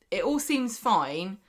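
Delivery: usual speaking voice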